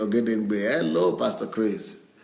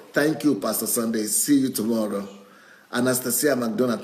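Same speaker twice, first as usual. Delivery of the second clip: ordinary talk, nothing marked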